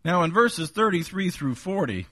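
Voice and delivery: ordinary talk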